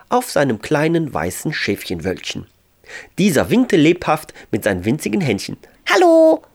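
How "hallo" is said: tiny angel's high, loud hello; cheerful squeak. A narrator's reading. Little angel greets Ralf from his cloud, cheerful squeak. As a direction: pipe up merrily, very high. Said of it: "piepste der kleine Engel vergnügt".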